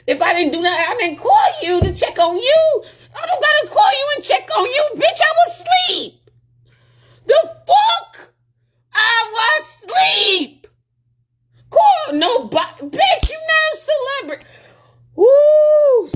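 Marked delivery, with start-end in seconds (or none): 0.9-12.9 squealing up high in outrage; disbelieving high shriek; high-pitched furious rant. Real, not acted. 12.9-16.1 high, loud taunt ending in a whoop; shrieked taunt and whoop; high mocking whoop. Real, not acted. Mocking shout at partner.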